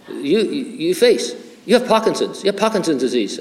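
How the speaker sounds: lightly put-on Chinese accent for the doctor. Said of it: "so I'm gonna do a Chinese accent".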